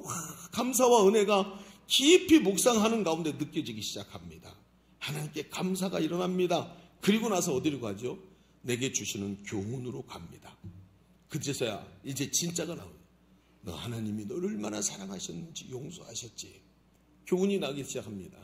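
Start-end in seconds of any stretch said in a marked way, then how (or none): none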